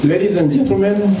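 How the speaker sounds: ordinary talk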